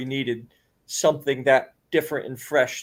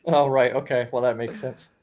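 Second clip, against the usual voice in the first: usual speaking voice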